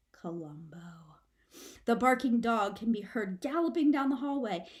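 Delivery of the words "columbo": slow, low dread whisper; name it like a doom; ominous hush. A narrator's reading. Narrator naming the dreaded dog, hushed and ominous.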